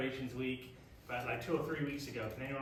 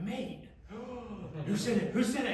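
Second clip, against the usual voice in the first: excited surprise; 5 semitones higher than usual. Retold or imitated. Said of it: "And I'm like".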